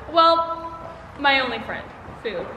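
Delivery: ordinary talk, nothing marked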